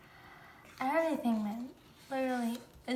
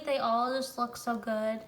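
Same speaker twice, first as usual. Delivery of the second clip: normal talking voice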